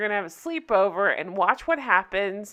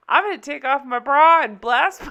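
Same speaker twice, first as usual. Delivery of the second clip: higher, louder quoted yell cracking into laughter; mock yell; 3 semitones higher, 6 dB louder than usual. Friend yelling out at the club, retold as a laughing mock yell. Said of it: "Artemis yells out".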